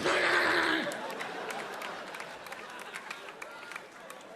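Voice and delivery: high, breathless unhinged laugh; kid's manic breathy cackle. Retold or imitated. Kid's reaction when the TV is switched off, manic cackle. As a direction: cackle like a kid losing it. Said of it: "they go".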